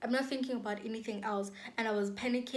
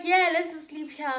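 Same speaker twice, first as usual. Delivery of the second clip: louder and higher, half laughing; amused repeat; 6 semitones higher, 8 dB louder than usual. Retold or imitated. Retelling her friend's absurd plan to sleep there, amused and louder. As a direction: replay the friend's line in disbelief.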